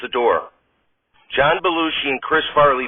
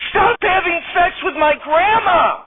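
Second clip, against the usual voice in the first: shrieking wife, much higher; shout; slower than usual. Acted. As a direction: yell at him in horror. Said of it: "and yells".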